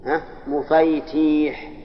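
مفيتيح drawn out slowly, every syllable of مفيتيح clear; spelled-out diminutive. Shown as a demonstration. Teacher showing students the full diminutive of مفتاح, slowly articulated. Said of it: "نقول على الأصل".

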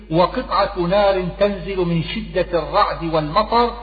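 ordinary talk

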